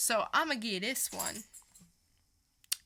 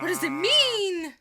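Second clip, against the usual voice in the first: much higher and louder, hammed-up alarm; mock dramatic gasp; 10 semitones higher, 11 dB louder than usual. Co-host reacting to the cliffhanger tease, hammed-up shock. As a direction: ham up a soap-opera shock.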